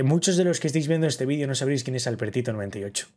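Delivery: ordinary delivery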